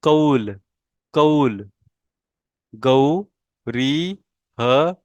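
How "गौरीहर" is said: गौरीहर stretched out slowly, rising at the end; slow model pronunciation. Shown as a demonstration. Teacher models गौरीहर slowly for the class. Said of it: "मेरे pronunciation के ऊपर आपने ध्यान देना है".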